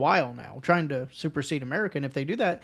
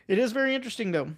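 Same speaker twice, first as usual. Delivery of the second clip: ordinary talk, nothing marked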